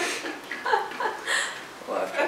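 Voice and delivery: high, airy laugh; laughter. Real, not acted. Presenters laughing at the handover, airy high laughter. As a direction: let out a run of high laughter.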